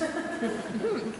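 soft laugh breaking the story; real laugh. Real, not acted. Teller laughing at his own joke, soft chuckle.

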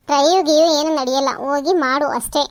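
loud, dismissive order; scolding. Acted. Mother-in-law ordering daughter-in-law to work, loud scolding. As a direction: shut down the excuse firmly.

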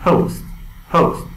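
"پست" with a popping, aspirated first sound; "پست" said punchily in English fashion; explosive pronunciation. Shown as a demonstration. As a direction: burst into the start of "پست". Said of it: "باید به صورت انفجاری تلفظ کنیم".

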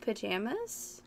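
pajamas with a short "a" as in jam; pronunciation check. Shown as a demonstration. Answering how it is pronounced, as a checking question. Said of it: "how do you pronounce that?".

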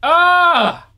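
normal talking voice

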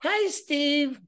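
put-on high, slow sweet old-lady greeting; lightly voiced granny picking up the phone; old grandma voice. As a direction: play a grandma happily answering her grandson. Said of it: "you're the old lady, grandma".

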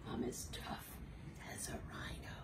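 soft unvoiced whisper; hushed tough rhino. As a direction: whisper it back. Repeats in whisper.

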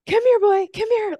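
very high, loud excited call to a dog. Shown as a demonstration. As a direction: call your dog in a squeaky thrilled voice. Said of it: "what I call dog calling energy".

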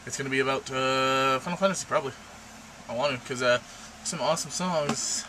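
ordinary delivery

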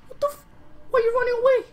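high, loud disbelieving cry; shocked outburst. Real, not acted. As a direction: yell in sudden disbelief. Enemy suddenly flees.

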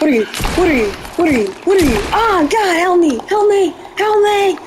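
yelping in terror as something closes in; panicked cry for help. Real, not acted. Gamer screaming at a creature closing in, panicked. Player panicking at approaching monster.

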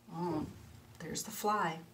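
ordinary delivery

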